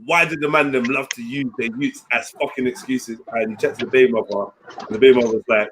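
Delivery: ordinary delivery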